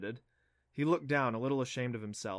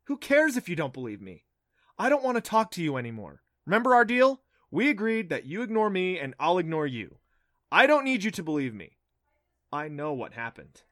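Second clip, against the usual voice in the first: raised, heated arguing; defensive; 5 semitones higher, 8 dB louder than usual. Acted. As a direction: snap back defensively.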